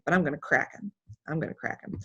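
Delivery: a determined murmur to herself; quiet resolve. Retold or imitated. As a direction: mutter the vow to yourself. Private vow to herself.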